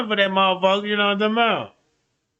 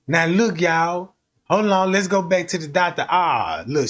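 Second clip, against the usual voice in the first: ordinary delivery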